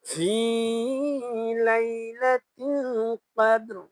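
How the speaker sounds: ليلة القدر drawn out very slowly in recitation; slow Quran recitation. Shown as a demonstration. Teacher reciting the verse for the class. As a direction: chant it slowly, stretching each vowel. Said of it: "আমার সঙ্গে আপনারা পড়ার চেষ্টা করবেন".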